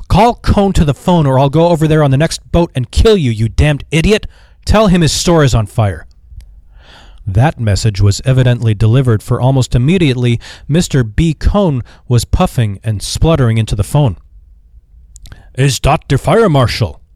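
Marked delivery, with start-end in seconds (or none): 0.1-6.1 higher, louder, furious phone shout; furious threat. Acted. Peck loses patience with the butler, furious shout. 15.5-17.0 put-on Yiddish accent. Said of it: "he managed to articulate".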